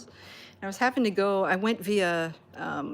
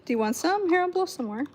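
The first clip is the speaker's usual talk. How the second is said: much higher, louder eager sing-song; playful pet talk; 7 semitones higher, 5 dB louder than usual. Real, not acted. Owner eagerly offering bubbles to the cats, high playful voice.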